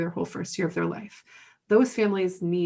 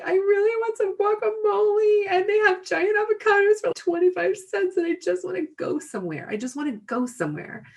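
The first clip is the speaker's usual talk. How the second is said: whiny pleading; 12 semitones higher than usual. Retold or imitated. Tired mom acting out her craving for guacamole, high-pitched whining. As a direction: whine high for a treat, settling toward the end.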